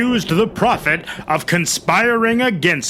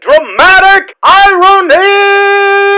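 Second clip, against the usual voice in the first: huge, much higher, louder drawn-out shout; shouted catchphrase; 13 dB louder, slower than usual. Acted. Narrator shouting the catchphrase on cue, much higher and louder. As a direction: belt it out like a game-show chant. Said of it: "Say it with me now".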